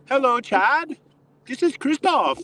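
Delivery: put-on high, polite German-accented voice; a formal German on the phone; German caller. Torqeedo owner phones Chad, polite German accent.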